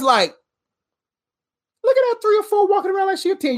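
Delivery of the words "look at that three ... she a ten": high, quick onlooker's aside; put-on voice of amazed onlookers; mock gossip. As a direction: imitate a gossiping onlooker, pitched up and fast. Acting out everybody's gossiping remark.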